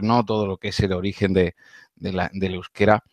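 ordinary delivery

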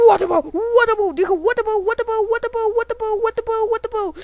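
high, loud looping alarm cry; play-acted panic chant; frantic repeated yell. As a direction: shout it over and over like a siren.

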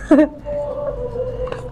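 high laugh. Real, not acted. Laughing at own joke.

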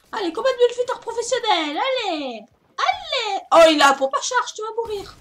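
loud, high excited cheering; excited hype. Real, not acted. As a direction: hype up the fight like a commentator. Hyped play-by-play of fight.

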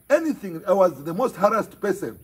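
ordinary delivery

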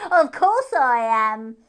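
slow, very loud and sure; as if it could be nothing else; smug hare. A narrator's reading.

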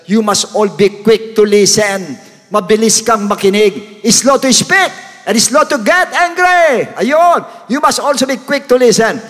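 loud, slow, rising high on the verse; full-force preaching. Acted. Preacher urges brothers and sisters to listen, loud high preaching. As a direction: drive each phrase home, pitch climbing.

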